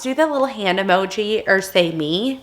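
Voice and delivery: usual speaking voice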